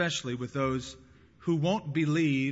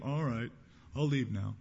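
acted dejection, dropping lower at the end; discouraged; 3 semitones lower, 4 dB quieter than usual. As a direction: give up meekly, voice sinking. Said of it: "would have been just discouraged".

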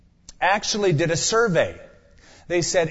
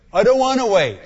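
higher, louder complaint; whiny protest; 6 semitones higher, 5 dB louder than usual. Retold or imitated. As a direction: whine like an impatient child. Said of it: "the dreaded answer".

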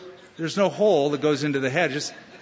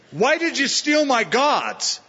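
angry outburst; 8 semitones higher, slower than usual. Acted. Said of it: "he burst out in anger and said".

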